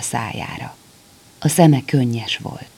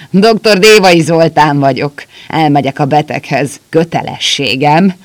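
put-on drunk laughing; 3 semitones higher, 7 dB louder than usual. A narrator's reading.

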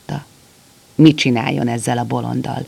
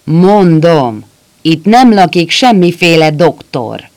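loud, angry insistence, hitting nem; angry repeat; 4 semitones higher, 9 dB louder than usual. A narrator's reading. Doctor angrily repeats to the soldier at his door, loud and angry. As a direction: bark it back at the soldier, fed up. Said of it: "Rézkígyó dühösen ismételte".

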